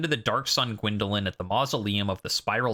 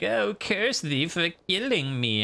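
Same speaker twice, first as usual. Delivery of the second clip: higher, slow, archaic moan of a god; mock dying god; 8 semitones higher, slower than usual. Streamer voicing the slain god toward the player, higher and slow. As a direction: ham up an undead god cursing his killer. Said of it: "he's like".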